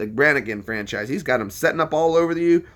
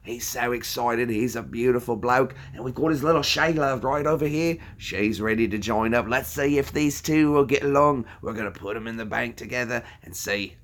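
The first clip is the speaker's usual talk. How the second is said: Australian wildlife presenter showing off a croc; Steve Irwin; 3 dB quieter than usual. Acted. Streamer presenting two crocs to viewers, Australian presenter impression. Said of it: "Steve Irwin".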